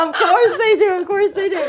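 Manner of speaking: high, loud, through laughter; real laughter bursting through the words; laughing reaction. Real, not acted. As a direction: crack up while saying it. Hosts crack up at child's admission.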